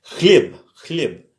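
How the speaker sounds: "chlieb" with a throaty kh; Russian kh sound. Shown as a demonstration. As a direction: stress the guttural in "chlieb".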